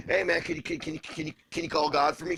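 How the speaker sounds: high, loud, stammering appeal; hammed-up begging, tripping over the first words; mock frantic plea. Host retelling a call to a friend for God's help, mock desperate.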